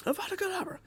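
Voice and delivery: high, lightly voiced incantation; spell casting voice. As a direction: say the curse in a high voice. Repeats the spell, unsure of pronunciation.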